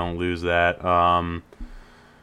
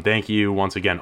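ordinary talk, nothing marked

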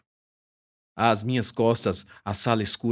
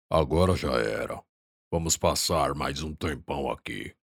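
low big man voice; 6 semitones lower, 4 dB quieter than usual. Acted.

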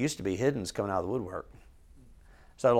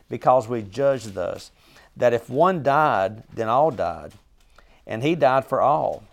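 a louder, measured reading of scripture; 5 dB louder than usual. Acted. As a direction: read the passage out to the Bible class. Reads scripture aloud.

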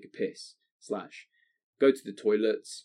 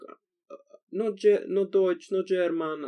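put-on high, slow foreign accent, halting; broken English; 10 semitones higher, 3 dB louder, slower than usual. Stranger at the rest stop answers in a high, slow foreign accent.

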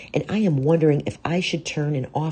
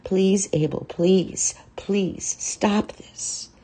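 higher, pleading, fading to a slow breathy end; crying plea; 7 semitones higher, slower than usual. A narrator's reading. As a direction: beg him through tears. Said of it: "The woman continues to cry".

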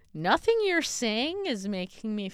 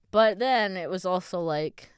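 normal talking voice

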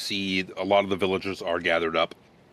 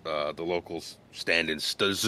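ordinary talk

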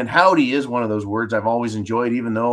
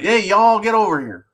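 lightly put-on Southern call, higher at the start; attempted Southern y'all; 6 semitones higher than usual. Shown as a demonstration. West Coast transplant attempting y'all, light mock-Southern call. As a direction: try a Southern "Hey, y'all" as an outsider. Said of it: "I have a hard time doing it".

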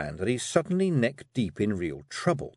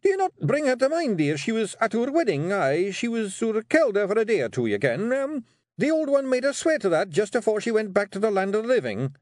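high, pleading Scottish voice; nervous Scottish husband; 12 semitones higher, 4 dB louder than usual. Acted. Rob reminds his wife of the old Kelda, nervously placating. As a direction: gabble excuses in a pitched-up voice.

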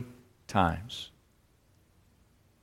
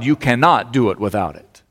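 ordinary delivery

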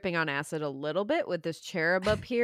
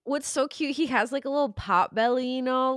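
usual speaking voice

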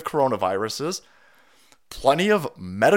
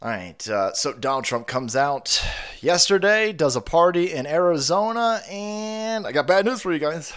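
usual speaking voice

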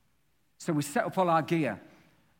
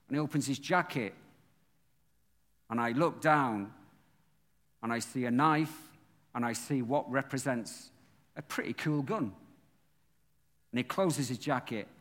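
usual speaking voice

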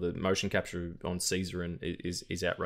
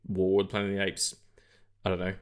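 ordinary talk, nothing marked

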